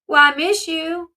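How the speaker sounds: changed sweet-talk voice. Retold or imitated. Father telling daughter he misses her, mildly mocked sweet-talk. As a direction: imitate a dad laying on affection. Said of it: "his voice changes".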